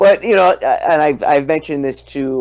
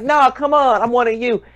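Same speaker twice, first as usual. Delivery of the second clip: much higher, whiny mock plea; mock pleading; 9 semitones higher than usual. Italian begging the Klan to join, mock pleading. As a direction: beg to be let in, hammed up. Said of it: "I'm like".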